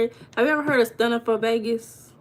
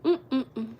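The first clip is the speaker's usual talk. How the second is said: ordinary talk, nothing marked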